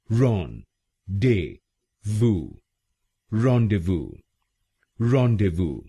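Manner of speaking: "rendezvous" as ron-day-voo, repeated; whole word demo. Shown as a demonstration. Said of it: "तो क्या बना?". (पूरा शब्द "rendezvous" रॉन-डे-वू बार-बार बोलिए)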